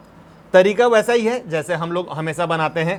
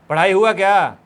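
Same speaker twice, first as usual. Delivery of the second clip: casual teacher; 2 semitones higher, 5 dB louder than usual. Retold or imitated. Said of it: "सीधे बोल देते हैं".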